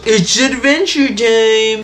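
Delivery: high, slow showman announcement; high-pitched, stretched-out catchphrase; Kane. Retold or imitated. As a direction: draw it out like a cartoon host. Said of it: "And then Kane goes".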